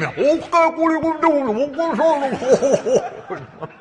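very high, loud, drawn-out whale; hammed-up whale call; comic whale voice. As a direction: wail it slowly like an eager cartoon whale. Whale replies to God.

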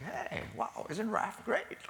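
high-pitched gushing fan voice; mock admiration. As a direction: gush like an impressed admirer. Acting out admirers he might want.

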